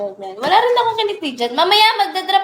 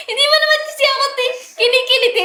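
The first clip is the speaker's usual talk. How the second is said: squealing high through laughter; laugh-talking while tickled; being tickled; 7 semitones higher than usual. Real, not acted.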